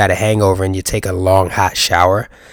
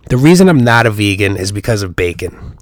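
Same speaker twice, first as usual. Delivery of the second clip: ordinary talk, nothing marked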